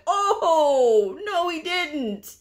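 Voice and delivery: high, loud, drawn-out mock shock; gasp-worthy outrage, played up for laughs; scandalized disbelief. Coder hearing "real coders" insult, scandalized disbelief.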